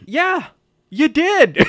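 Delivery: high-pitched imitation of the girlfriend; mock agreement. Acts out Jessica's curt reply.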